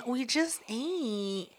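soft, slow, drawn-out imitation; mock demure refusal. Host imitating her guest turning down food, soft and drawn out. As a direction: decline softly, dragging it out.

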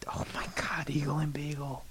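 awed whisper. Real, not acted. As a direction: breathe it out in amazement. Awed by the premise.

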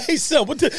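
high, mock-outraged coworker; imitating coworker. Voicing coworker mad at rival cap.

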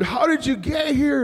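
high, incredulous question; astonished fan. Retold or imitated. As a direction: imitate a fan who can't believe it. Acting out astonished fan's question.

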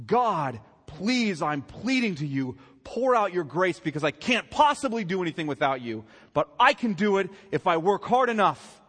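loud, high pleading voice; mock pleading prayer. Preacher contrasting a true prayer with the singer's, loudly pleading. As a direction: beg God hard, then turn.

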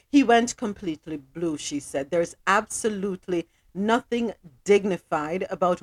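measured, sober news read. Acted. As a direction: deliver the story straight. Reads the news.

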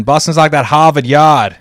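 attempted Boston accent, R dropped; tentative imitation Boston accent. Shown as a demonstration.

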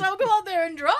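quoting while laughing; laughing high and loud; laughing through the words. Retold or imitated. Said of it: "He goes".

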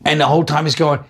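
ordinary talk

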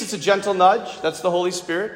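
ordinary talk, nothing marked

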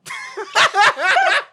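shrieking laughter; loud high laugh. Real, not acted. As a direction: crack up loudly. Laughs hard at story.

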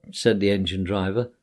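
ordinary delivery

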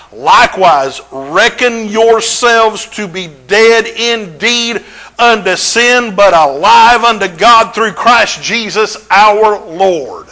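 lightly proclaimed verse; scripture reading. Acted. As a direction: declaim the verse slowly.

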